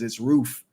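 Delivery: "roof" with a long oo like food. Shown as a demonstration. Husband correcting wife's Wisconsin roof, long oo. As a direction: stretch the oo in "roof". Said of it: "There's two O's in it".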